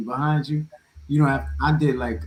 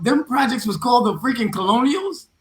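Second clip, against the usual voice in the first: higher, louder, amazed; surprised outburst; 10 semitones higher, 5 dB louder than usual. Real, not acted. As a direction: blurt it out in disbelief. Surprised outburst about project name.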